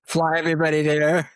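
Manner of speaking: laughing through the words at the irony; a wry laugh breaking into the line. Real, not acted. Telling friends about flying everyone to Bermuda, laughing.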